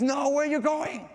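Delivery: high-pitched cry after departing friends; alarmed raindrop. Acted. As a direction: call out high, dismayed. Raindrop calls to falling friends.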